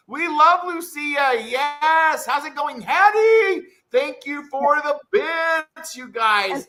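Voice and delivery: high, loud, gushing shout-outs; excited cheering. Real, not acted. Streamer thanking viewers and welcoming a friend, excited shouting. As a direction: cheer them on at high pitch.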